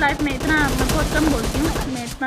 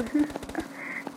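soft laugh; 7 dB quieter than usual. Real, not acted. Laugh after mock lament.

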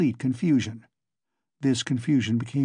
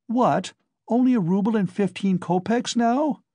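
higher, indignant question at the price; surprised protest; 7 semitones higher than usual. Acted. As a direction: balk at the offer, voice jumping up. Pawnbroker names a low sum.

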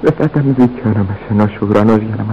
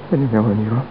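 usual speaking voice

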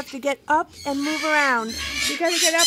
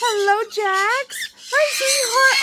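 pet-talk greeting; 7 semitones higher, 3 dB louder than usual. Real, not acted. Owner greeting her bird Jax, cooing.

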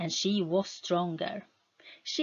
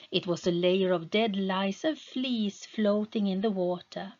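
plain reading voice

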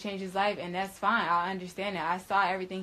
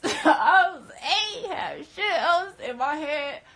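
much higher, loud laughing words, slow; 9 semitones higher, 8 dB louder, slower than usual. Real, not acted. Telling viewers she only wanted home, laughing. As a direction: laugh through the memory.

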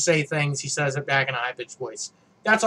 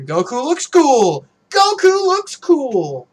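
high, loud, drawn-out mock cheer, building higher; hammed-up hype; 10 semitones higher, 8 dB louder, slower than usual. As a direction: cheer it slowly and ever higher, over the top. Hyped cheering at the dressed-up Goku.

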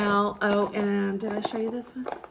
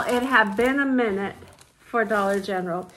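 ordinary delivery

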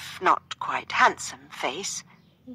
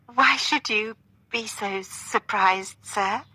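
slightly theatrical, slow, higher voice; like a stage actor; 5 semitones higher, slower than usual. A narrator's reading. Catherine answering her partner, lightly theatrical. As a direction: play along with a touch of stage acting. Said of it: "speaking like an actor in the theatre".

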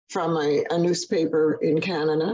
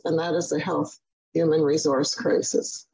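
ordinary talk

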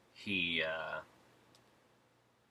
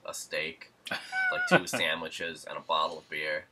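usual speaking voice

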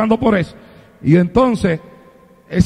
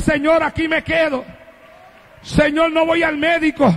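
raised, high, impassioned plea to the Lord; crying out to God; 6 semitones higher than usual. Retold or imitated. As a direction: call out to God with full conviction.

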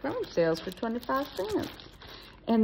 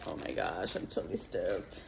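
lower, softer mock surfer dude saying stoked; 10 dB quieter than usual. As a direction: drop into a goofy low dude voice. Playful reaction to stickers.

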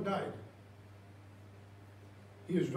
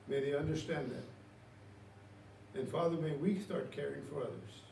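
soft, low pastoral prayer voice; 5 semitones lower, 8 dB quieter than usual. Acted. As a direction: pray over the congregation gently. Leads congregation in prayer.

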